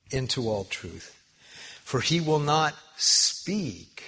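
loud, slow, emphatic Bible reading; scripture reading. Acted. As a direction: proclaim each phrase with long pauses. Reads scripture aloud emphatically.

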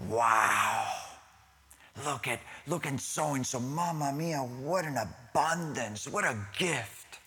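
drawn-out, hammed-up amazement; mock awe. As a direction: marvel like an impressed onlooker. Mimics onlookers admiring rich givers' gifts.

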